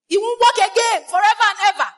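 loud, high pronouncement; fiery preacher decree; emphatic declaration. Acted. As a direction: decree it like a curse. Preacher cursing offender emphatically.

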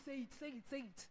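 hushed, repeated prodding; very soft, insistent tempter; quiet urging. Acted. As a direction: egg them on under your breath. Inner voice urging a retort.

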